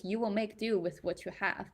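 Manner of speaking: normal talking voice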